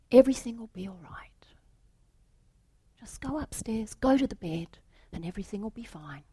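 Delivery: hushed, quick reassurance; soothing whisper. Acted. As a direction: whisper him towards the room. Manager reassures the salesman.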